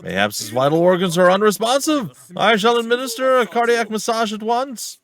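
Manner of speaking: loud, high-pitched theatrical proclamation; grandiose would-be medic. Acted. Eccentric companion offering cardiac massage to the victim, grandiose and loud. Voices a grandiose game character.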